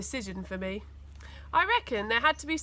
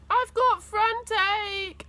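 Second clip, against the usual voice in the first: slow, high, louder mock moan; cheeky copycat excuse; 10 semitones higher, 4 dB louder, slower than usual.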